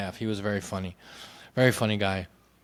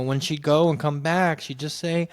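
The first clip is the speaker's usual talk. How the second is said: ordinary talk, nothing marked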